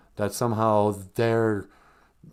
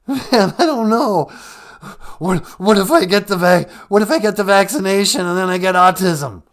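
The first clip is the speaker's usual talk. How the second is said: much louder, higher panicked caller; a scared caller losing his nerve; Cowardly Lion freaking out; 5 semitones higher, 9 dB louder than usual. Acted. Radio caller panicking about the vaccine, loud and high. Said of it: "he sounds totally different today".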